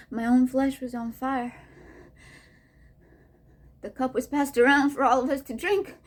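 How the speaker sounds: ordinary delivery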